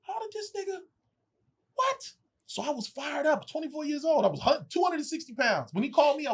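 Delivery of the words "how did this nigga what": squeaky high, softer stunned question; high stunned disbelief. Retold or imitated. Young actor stunned by director's call, high disbelief. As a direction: gasp it out high in shock.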